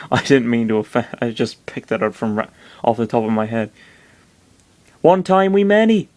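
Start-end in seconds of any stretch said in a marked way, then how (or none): 5.0-6.1 high creature voice gone Welsh; botched West Country that comes out Welsh; lilting high Welsh-sounding critter; accidental Welsh accent. Acted. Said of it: "that's totally Welsh".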